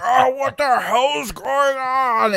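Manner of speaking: drawn-out mock wail, high and loud; exaggerated slow, high-pitched cry of alarm; startled groggy outburst. Husband woken by pounding on the door, hammed-up startled wail.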